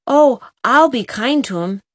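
eager. A narrator's reading. Jem promising Roddy to care for the dog, eager. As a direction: promise it gladly, "I'll" bright and quick. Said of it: "said Jem eagerly".

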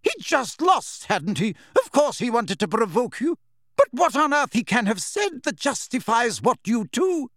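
high, indignant scolding; exasperated, furious telling-off; shouted outrage. A narrator's reading. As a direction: berate them at high pitch. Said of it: "shouted Professor McGonagall".

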